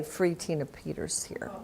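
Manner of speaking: hushed, unvoiced whisper; captain whispering the banned words. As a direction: whisper it like a forbidden phrase.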